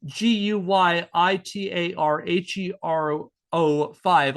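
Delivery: slow, deliberate letter-by-letter spelling; slow spelling that pokes fun at the misspelling. Shown as a demonstration. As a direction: spell each letter out slowly for emphasis. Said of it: "She spells it".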